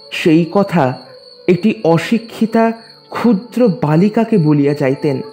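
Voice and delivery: ordinary talk, nothing marked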